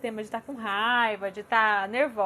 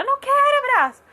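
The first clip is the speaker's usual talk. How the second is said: high, loud imitation of a protesting child; child's shouted refusal; 14 semitones higher, 10 dB louder than usual. Daughter refusing mom's hug mid-cry, imitated high shout. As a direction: yell it like a small child refusing.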